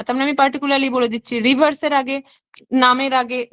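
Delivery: ordinary talk, nothing marked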